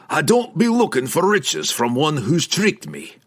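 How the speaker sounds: growling dwarf voice; harsh and severe. A narrator's reading. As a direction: growl it like a threat. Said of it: "Bruner growled".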